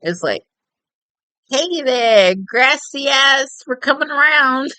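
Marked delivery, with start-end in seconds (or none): 1.5-4.7 put-on a cheery Texan accent rattling it off. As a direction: play a loud Texan tourist. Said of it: "Texan, right?".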